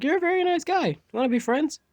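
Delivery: high, bright-eyed friendly offer; eager friendliness. Acted. Shy new classmate offering friendship, eagerly.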